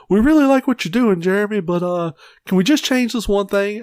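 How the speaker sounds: butter him up like a meddling producer; executive producer voice. Retold or imitated. Executive producer politely asking the director for a change, in a fake-friendly voice. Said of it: "some executive producer would come on set and be like".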